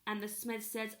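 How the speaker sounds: ordinary delivery, nothing marked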